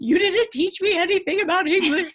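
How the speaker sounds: high, loud imitation of a freaked-out daughter; mock panic. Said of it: "she was all panicked and".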